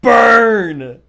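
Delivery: loud high shout of delight; a gleeful roaring BURN. Real, not acted. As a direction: yell it like a zinger landed. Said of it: "There's some sick burns".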